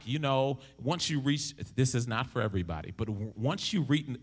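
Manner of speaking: ordinary delivery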